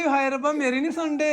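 high whiny imitation; mock an aunty's complaint; dramatic aunty. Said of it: "ਅੱਛਾ, ਸੱਚੀ ਕਹਿੰਦੀ".

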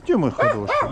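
very high, sing-song cooing. Real, not acted. Volunteer coos at the nervous pet in high baby talk. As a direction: coo up high to coax the animal.

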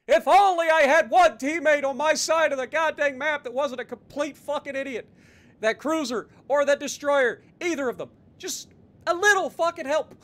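frustrated yelling at teammates; loud exasperated rant; angry. Real, not acted.

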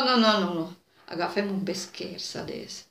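ordinary delivery, nothing marked

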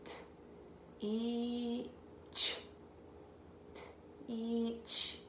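soft separate sounds, one by one; sounding out softly. Shown as a demonstration. Teacher sounding out a tricky word for the child. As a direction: break the word into quiet sounds. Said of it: "This one's tricky".

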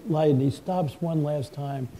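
ordinary delivery

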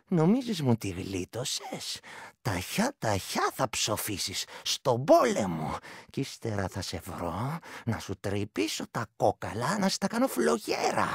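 slow, eerie otherworldly voice; creature prophecy. Acted. The vrykolakas curses the fleeing shepherd, slow eerie voice. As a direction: drag out the curse like a doom. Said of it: "μου λέει".

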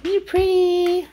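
high, slow, drawn-out coo. Real, not acted. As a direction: stretch it out sweetly to the dog. Cooing at dog slowly.

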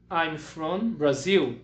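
very slow, clear English answer; teacher modelling the answer slowly; slow model answer. Shown as a demonstration. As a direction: say it slowly so kids can copy. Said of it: "E eu responderia".

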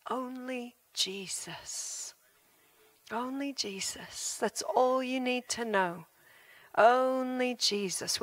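quiet, slow, lingering on each word; hushed preacher's reminder; slow soft emphasis. Acted. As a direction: let each word sink in gently.

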